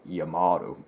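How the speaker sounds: badly faked American 'yuh-MAH-doh' for Yamato; bad American accent. Shown as a demonstration. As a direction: fake an American accent, softening the t to d. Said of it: "that's a really bad American accent".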